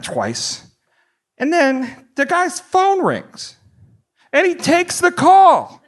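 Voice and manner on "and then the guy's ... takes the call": high, drawn-out indignant squeal; hammed-up outrage, slow and very high; incredulous outrage. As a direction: stretch each word in appalled disbelief. Outraged at cinema phone call.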